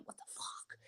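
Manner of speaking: breathy under-the-breath whisper; whispered aside. Real, not acted. Grumbling to herself about benching him, whispered. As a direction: drop to a whisper for the curse.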